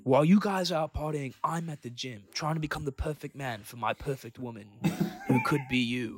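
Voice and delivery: lightly put-on British accent with lisp; a hint of mock British influencer. Imitating a gym guy's thirst-trap video, lisping British accent. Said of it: "like, a lisp and a British accent".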